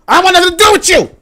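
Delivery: high, loud indignant yell; mock outburst. The colony shouting at Britain, a high loud defiant yell. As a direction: throw the line out high and loud.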